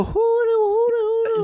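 very high hooting fife noise; imitated fife. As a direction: toot like a fife in falsetto.

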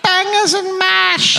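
very high mock Cockney greeting; a bad English accent, chirpy ghost; shitty English accent. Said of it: "doing an impression".

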